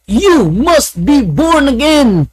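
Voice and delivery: slow, high pastor proclaiming; loud preaching. Retold or imitated. Pastor shouting across to the Adventist church, slow and high. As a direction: boom it out slowly like a pastor from the pulpit. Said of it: "Ang lakas ng boses niya".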